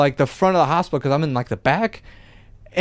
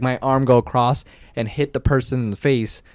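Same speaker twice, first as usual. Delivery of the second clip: usual speaking voice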